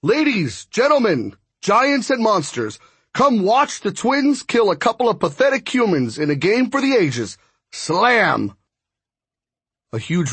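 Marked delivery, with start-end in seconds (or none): 0.0-8.6 booming announcer. Acted. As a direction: boom it out over the PA. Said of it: "a voice boomed over a loudspeaker".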